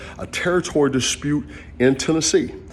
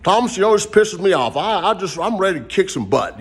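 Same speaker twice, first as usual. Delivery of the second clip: franchisee quote; 7 semitones higher than usual. Retold or imitated. Retelling the country franchisee threatening a fight, mock angry. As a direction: mimic the angry franchisee, pitched up. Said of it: "he says".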